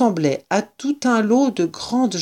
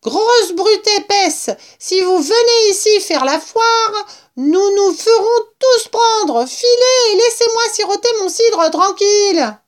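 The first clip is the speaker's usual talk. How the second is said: very high, loud rat rant; vociferating rat; 5 dB louder than usual. A narrator's reading. Rat scolding the intruders, high loud tirade. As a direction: rant in a squealing rodent voice. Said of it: "vociféra le rat".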